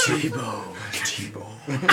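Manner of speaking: soft breathy whisper. Retold or imitated. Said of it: "in this very breathy voice".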